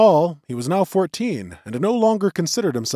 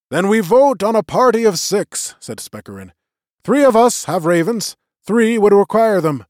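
lightly voiced elder, raised and formal; magician's voice; 7 semitones higher, 7 dB louder than usual. A narrator's reading. Old magician opening the vote to the clan, lightly voiced and raised. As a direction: address the gathering like a presiding elder.

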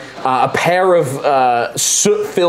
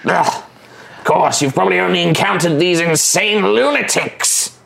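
higher, condescending goblin voice, touches of threat; smart goblin; 5 semitones higher than usual. Acted. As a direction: sound like a clever goblin tired of fools.

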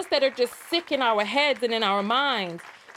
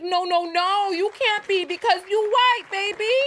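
put-on gushing boyfriend; 8 semitones higher, 5 dB louder than usual. Voicing boyfriend's gushing replies.

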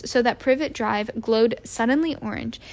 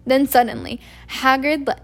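ordinary delivery

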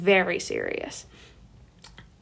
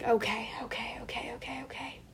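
breathless string of whispered okays; panicked whisper; 8 dB quieter than usual. Retold or imitated. Answering her brothers in a nervous whisper. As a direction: whisper it over and over, giving in under pressure.

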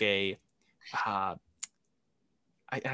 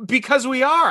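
high, loud, blunt comeback; mock blunt answer; 10 semitones higher, 8 dB louder than usual. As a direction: fire it back loud and curt.

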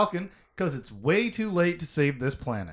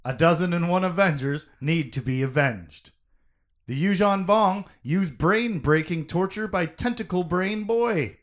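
announcer voice; 5 dB louder than usual. Acted. Redone TV style recap.

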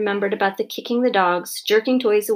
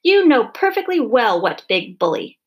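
loud, emphatic accusation; shrieking indignation; 2 semitones higher, 5 dB louder than usual. A narrator's reading. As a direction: scold her, outraged, leaning on each word. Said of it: "shrieked Missus Eager".

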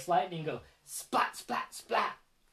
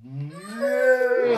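cow moo; 4 semitones higher, 6 dB louder than usual. Acted. Dad moos with child.

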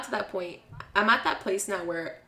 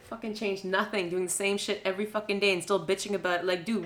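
ordinary talk, nothing marked

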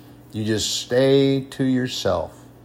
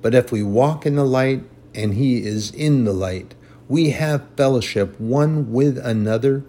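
emphatic scripture reading; 2 semitones higher, 4 dB louder than usual. Acted. Preacher reads First John to the congregation, emphatic scripture reading. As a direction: read it out firmly, weighting each phrase.

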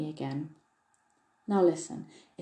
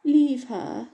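higher, louder mock-dignified mimicry; teasing parody of a haughty little order; droll imitation; 7 semitones higher, 6 dB louder than usual. A narrator's reading. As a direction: mimic the lady's commanding tone, chuckling elf. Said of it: "with a droll imitation of her voice and manner".

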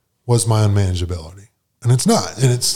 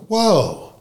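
mock surprise; 10 semitones higher than usual. Taken aback at being called liar.

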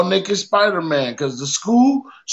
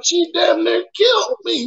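indignant protest; 10 semitones higher than usual. Retold or imitated. Defending himself in fight.

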